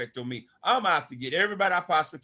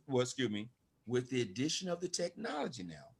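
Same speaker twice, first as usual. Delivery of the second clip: ordinary talk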